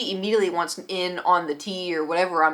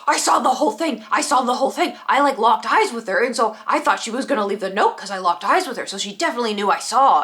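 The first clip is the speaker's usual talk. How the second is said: mock adrenaline rush; 2 semitones higher, 4 dB louder than usual. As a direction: imitate a man hyped on adrenaline. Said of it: "so hyped on adrenaline".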